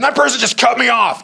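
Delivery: high, loud indignant yell; hammed-up outrage at a driver; mock road rage. Shown as a demonstration. Driver cut off in traffic, mock angry shout. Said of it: "You see how that just changed from".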